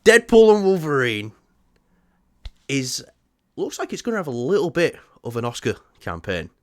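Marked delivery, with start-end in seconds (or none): 0.0-4.7 loud, high, punchy announcer opening; big announced title, pitched up high; presenter's topic intro. Real, not acted. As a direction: kick off the segment at full voice. Launches topic intro for clip.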